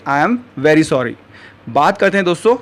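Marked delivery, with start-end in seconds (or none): 0.1-1.1 slow model phrase. Shown as a demonstration. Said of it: "तो English में आप कहेंगे".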